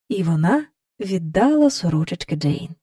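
ordinary delivery

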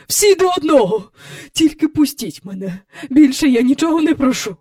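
high, hurried pleading; desperate begging. A narrator's reading. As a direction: gabble out a frightened plea. Miss Andrew begs to be freed.

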